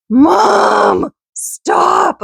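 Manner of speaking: high, drawn-out mock whine; irritated kid. Kids fed up with mom's voice, in an imitated irritated whine. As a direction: imitate an annoyed child pleading. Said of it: "they get really irritated".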